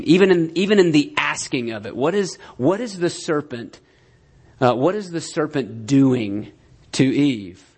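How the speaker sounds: normal talking voice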